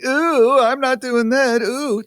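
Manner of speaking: high, squealing mock squeamish voice; squeamish mock refusal. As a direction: act out a grossed-out refusal. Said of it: "for not going".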